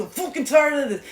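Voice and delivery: acted high-pitched whine of exasperation; fed-up outburst. Coach mimicking what the listener does, fed-up outburst. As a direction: mimic someone who has had enough. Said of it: "this is what you do".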